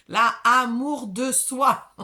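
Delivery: « amour de soi » très fort, aigu et lent; martèle lentement « amour de soi » à pleine voix; insistance appuyée. Real, not acted. Montre la carte avec insistance. (« amour de soi » lancé fort, en détachant les mots)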